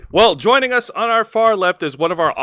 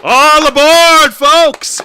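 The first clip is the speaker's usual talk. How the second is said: slow, loud, higher train-conductor call; announcer call; 7 semitones higher, 8 dB louder, much slower than usual. Acted. Host's hype show opener.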